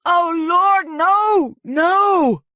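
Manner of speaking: very high anguished shout; howling cry. A narrator's reading. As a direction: wail in despair. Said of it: "Skebel was howling".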